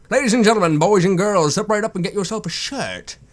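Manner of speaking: booming showman hawking wares; loud carnival barker. Acted. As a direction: step right up, circus barker style. Sponsor plug, barker opening.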